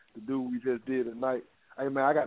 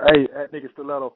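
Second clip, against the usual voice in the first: calling out loud to the online audience; loud shout-out; 11 dB louder than usual. Real, not acted. Host calls out to Nikki Stiletto, loud and hyped. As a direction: belt her name out like a host hyping the crowd.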